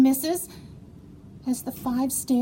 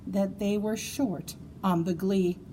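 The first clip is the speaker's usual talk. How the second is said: usual speaking voice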